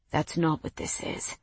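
hushed, unvoiced denial; whispering. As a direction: say it under your breath. Mason quiet denial.

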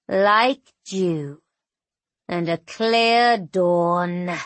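slow, hushed, relishing cruelty; menacing djinn voice. Acted. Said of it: "he says".

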